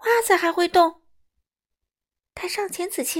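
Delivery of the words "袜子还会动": high, loud startled question; acted storybook alarm. A narrator's reading. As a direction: exclaim in disbelief, pitched up. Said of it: "米歇尔吓了一跳".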